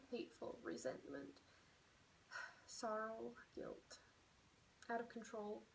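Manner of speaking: starts firm, then sinks to a hushed whisper; fading into sorrow. Shown as a demonstration. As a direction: let the list of feelings fade to a whisper. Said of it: "I'm gonna say".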